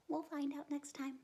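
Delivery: usual speaking voice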